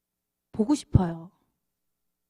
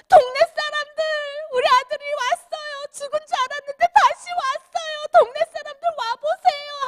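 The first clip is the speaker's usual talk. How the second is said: much higher, loud announcing cry; joyful father calling out; 13 semitones higher, 5 dB louder than usual. Retold or imitated. Father calling neighbours because his son came home, overjoyed. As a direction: call the whole village to celebrate.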